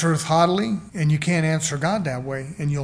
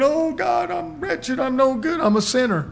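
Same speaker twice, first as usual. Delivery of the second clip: high wailing mock lament, much higher; hammed-up sinner wailing to God; mock bawling; 9 semitones higher than usual. Preacher mocking a sinner's high wailing cry. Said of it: "repentance is bawling and crying".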